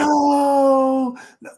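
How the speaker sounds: high, loud mock wail of no; hammed-up crying no; mock crying protester. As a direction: imitate a sobbing woman on the street. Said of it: "She's like".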